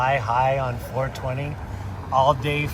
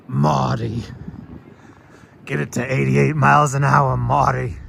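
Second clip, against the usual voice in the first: louder frantic Doc Brown shout; Doc Brown impression; 6 dB louder than usual. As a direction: yell at Marty like Doc Brown. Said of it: "clock tower from Back to the Future".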